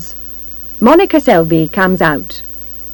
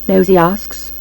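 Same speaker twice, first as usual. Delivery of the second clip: ordinary delivery, nothing marked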